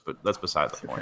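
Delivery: usual speaking voice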